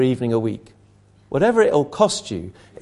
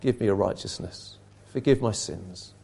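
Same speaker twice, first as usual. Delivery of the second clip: much lower and softer, a humble prayer; lowered humble plea; 6 semitones lower, 7 dB quieter than usual. Retold or imitated. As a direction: drop low and quiet, begging forgiveness.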